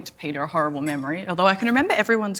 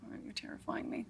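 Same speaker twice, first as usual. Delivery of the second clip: whispering; 2 semitones higher, 20 dB quieter than usual. Pretend. Whispered mock fear at partner.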